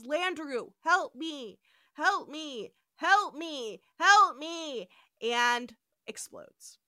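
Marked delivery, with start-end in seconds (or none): 0.0-4.9 growing louder cries for help; mock pleading. Retelling the dying computer begging, each help me louder. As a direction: plead more desperately each time. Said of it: "Landru says".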